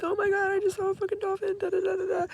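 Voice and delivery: high, squealing excitement; excited. Retold or imitated. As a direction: squeal it out, thrilled. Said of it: "I came out of the water like".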